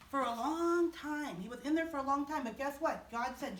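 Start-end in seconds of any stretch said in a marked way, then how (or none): 0.1-1.4 slow. Shown as a demonstration. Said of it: "repeated phrase, slow take". 1.4-2.4 quick, rattled-off repeat; fast. Shown as a demonstration. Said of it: "repeated phrase, fast take".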